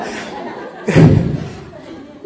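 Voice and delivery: airy wheezing laugh; breathy laugh. Real, not acted. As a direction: laugh helplessly at the mistake. Laughing at missing 佛. (气声大笑)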